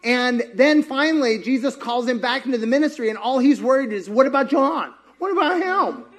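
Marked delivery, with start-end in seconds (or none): 4.1-6.0 high, whining Peter; whiny Peter. Retold or imitated. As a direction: whine like a nervous disciple. Peter voiced worried.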